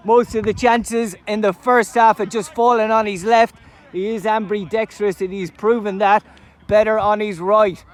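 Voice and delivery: loud, high, thrilled goal commentary; excited goal call. Real, not acted. Commentator calling the goal to the crowd, roaring with excitement. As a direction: erupt as the ball hits the net.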